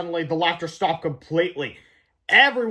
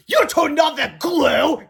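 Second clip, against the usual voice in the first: much higher, louder, slow furious shout; angry shout; 10 semitones higher, 6 dB louder, much slower than usual. A narrator's reading. Angry principal shouting at the boys. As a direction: roar at the boys. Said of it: "he shouted".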